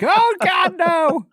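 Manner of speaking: hammed-up frightened whimper of a little boy; mock terrified squeal. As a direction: squeal it like a scared kid on a coaster. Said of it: "Little seven-year-old Bob is still inside there, just like".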